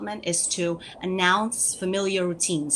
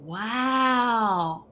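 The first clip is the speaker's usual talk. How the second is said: amazed wow; 3 semitones higher than usual. Shown as a demonstration. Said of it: "you go".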